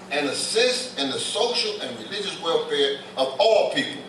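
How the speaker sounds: "all" leaned on with a rising lift; preacher stressing "all". Shown as a demonstration. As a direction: hit the word "all" hard. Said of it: "And I stress the word all".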